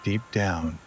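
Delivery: guided meditation. Acted. Host guides listeners to relax before sleep, guided meditation.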